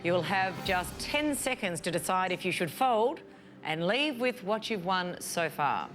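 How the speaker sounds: ordinary talk, nothing marked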